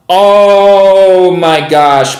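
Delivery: loud, hugely drawn-out exclamation; real excited cry, very slow and loud; thrilled astonishment. Real, not acted. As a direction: stretch it out, amazed by the spike. Price spikes in his favour.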